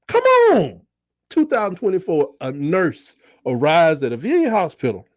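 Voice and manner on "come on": high, stretched admiring come on; admiring doctors. Retold or imitated. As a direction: doctors impressed by the driver. Doctors reacting to driver.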